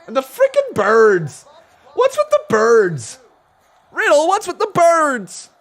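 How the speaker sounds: high, loud, drawn-out complaint; loud exasperated outburst. Real, not acted. Streamer yelling about the birds to Riddle, exasperated. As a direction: cry out in exasperated bafflement. Frustrated outburst at wrestling show.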